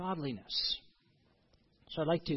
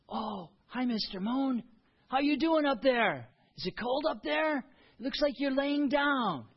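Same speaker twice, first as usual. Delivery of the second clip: put-on higher, sing-song voice for a small child; talking to a toddler; 7 semitones higher than usual. Grandpa and grandson greeting the moon, gently higher.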